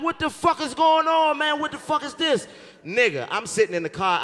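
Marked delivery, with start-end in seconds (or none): 0.0-2.5 high-pitched retold bewilderment; baffled alarm. Telling friends his body acted up, baffled and alarmed. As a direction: ask it high, in mock panic.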